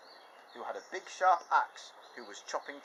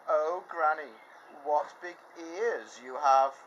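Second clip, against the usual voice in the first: put-on louder, slow amazed girl; wondering girl; 6 semitones higher, 7 dB louder, slower than usual. Girl wondering at grandma's ears, amazed. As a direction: marvel at each feature.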